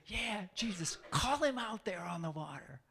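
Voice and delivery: put-on sneering disciple voice; mock jealous disciple. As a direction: egg Jesus on with mock spite.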